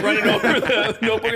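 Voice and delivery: ordinary talk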